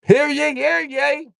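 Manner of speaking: high, loud mock herald crying hear ye; hammed-up town crier announcing; town crier call.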